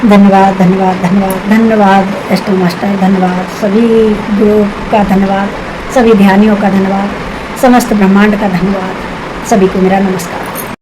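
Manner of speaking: low, reverent closing gratitude; devotional thanks. Acted. Meditator thanking teacher and cosmos, low and reverent.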